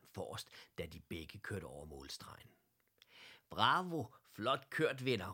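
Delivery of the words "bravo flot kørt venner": loud, high cheer; shouted praise. A narrator's reading. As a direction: call out hearty congratulations to friends. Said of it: "råbte hr". (højt råbt ros)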